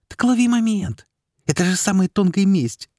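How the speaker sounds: high eager coaxing; eager urging. A narrator's reading. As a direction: urge him on with relish. Fairy eagerly urges revenge.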